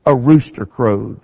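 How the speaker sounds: ordinary delivery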